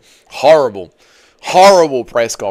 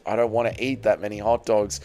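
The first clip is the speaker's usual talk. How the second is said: ordinary delivery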